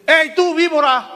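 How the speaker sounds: high, loud accusing shout; shouted rebuke. Acted. John the Baptist shouting down the Pharisees in line, loud and high. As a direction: bellow it at the crowd like John the Baptist. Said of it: "es que le hago así y le hacen así".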